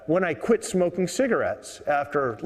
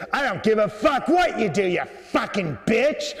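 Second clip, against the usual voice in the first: mock-furious cursing, pitched up; angry old man; 6 semitones higher than usual. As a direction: play an old man snapping back in anger. Old man swears back at woman.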